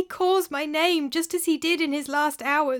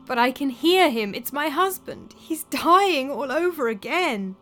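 frightened; 5 semitones higher than usual. Acted. Terrified widow hearing ghost.

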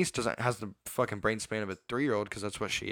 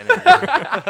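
laughing; 15 dB louder, much slower than usual. Real, not acted. Laughing at own rage method.

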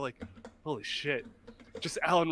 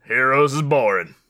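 silly put-on voice; 7 semitones lower, 7 dB louder than usual. Podcast bit voice clip.

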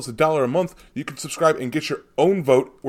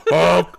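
goose honk; 3 semitones higher, 6 dB louder than usual. Pretend. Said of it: "Quebecois Canadian geese".

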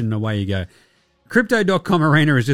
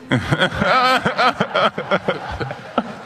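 laughing; 5 semitones higher than usual. Real, not acted. Laughing at own joke.

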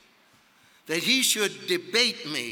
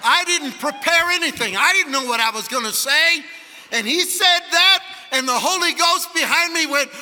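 loud, high, driving sermon delivery; full-force pulpit preaching; fired-up preaching; 9 semitones higher, 8 dB louder than usual. Real, not acted. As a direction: shout the story to a roaring congregation.

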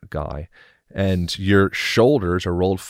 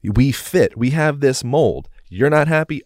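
higher, emphatic pleading with a partner; insistent spouse; 7 semitones higher, 4 dB louder than usual. Acted. Spouse arguing to keep the relationship, insistent. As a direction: press the point, higher and louder.

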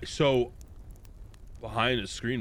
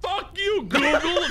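high, loud outburst; outraged yell; 5 dB louder, slower than usual. Real, not acted. Losing the argument to Google, mock-outraged shout. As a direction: yell at it, half laughing.

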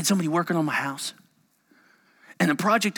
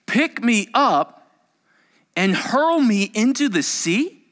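higher, slow and emphatic; slow dramatic reading; 7 semitones higher, 4 dB louder, slower than usual. Acted. Preacher rereading Jonah's words to the room, slow. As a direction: lean on each word slowly.

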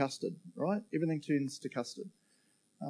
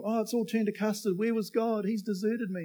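whiny believer; 8 semitones higher than usual. Retold or imitated. Impatient believer blames God.

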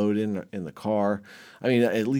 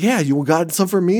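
higher and louder hobo asking for dope; imitating hobo; 8 semitones higher, 8 dB louder than usual. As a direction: beg like a pushy hobo, raised voice. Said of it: "he's like".

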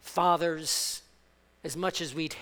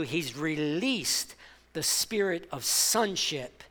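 normal talking voice

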